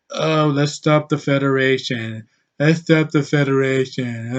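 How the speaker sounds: a lightly voiced lifeless reading; deadpan it, no energy at all; mock bland line reading. Mocking a flat performance, lightly voiced bland reading. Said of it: "She didn't go".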